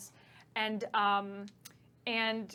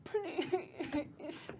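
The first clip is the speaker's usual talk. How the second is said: weak pleading; 8 semitones higher, 8 dB quieter than usual. Acted. Beaten captive begs for mercy.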